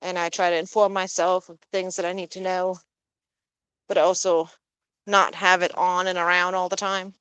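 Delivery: ordinary talk, nothing marked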